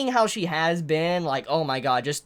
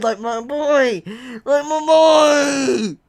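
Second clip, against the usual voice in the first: exasperated cry; 3 semitones higher, 4 dB louder, much slower than usual. Real, not acted. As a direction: stretch it out in despair.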